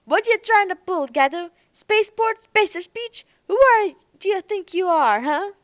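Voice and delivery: loud, high, aggressive sneering questions; hostile challenge. Acted. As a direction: shout him down, suspicious and hostile. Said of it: "looked at him sharply".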